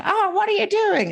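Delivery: put-on high, sing-song surprise to a child; high playful parent voice; playful mock surprise. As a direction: play it up for a toddler. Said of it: "I wave it at her and I say".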